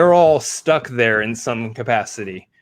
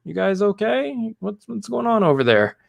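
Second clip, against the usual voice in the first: Vision; 10 semitones higher than usual. Retold or imitated. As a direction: pitch it up and stretch it out. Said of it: "He's like".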